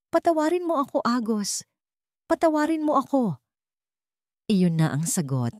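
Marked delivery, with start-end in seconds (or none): 0.1-3.4 high, broken pleading through sobs; sobbing plea. Acted. Dying wife begging her husband's forgiveness, sobbing. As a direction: beg forgiveness while weeping. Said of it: "humagulgol si Alani".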